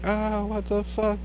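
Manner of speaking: high startled cry of disbelief; high shocked swear; shocked outburst. Retold or imitated. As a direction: yelp it in sudden shock. Shocked reaction when glass cut.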